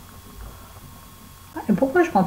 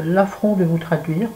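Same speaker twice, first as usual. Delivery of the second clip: usual speaking voice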